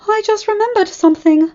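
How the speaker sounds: high, loud burst of alarm; exclaiming. Acted. Rarity remembering the gala in alarm, high and loud. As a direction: blurt it out in a sudden panic. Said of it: "Rarity exclaimed".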